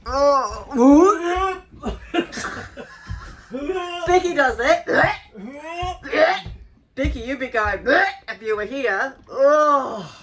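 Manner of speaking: retching at a foul smell; gagging. Real, not acted. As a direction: gag between words. Gagging at the stink.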